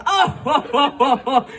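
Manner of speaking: high hearty ho-ho laugh; actor's Santa laugh. Retold or imitated. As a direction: mimic the ghost's booming chuckle. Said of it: "he's going".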